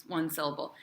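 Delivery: ordinary delivery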